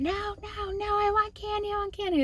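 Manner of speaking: high, breathy imitation of daughter begging; whispered whiny child. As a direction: mimic a small child pleading for candy. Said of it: "she kept going".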